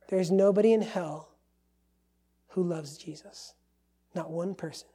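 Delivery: ordinary delivery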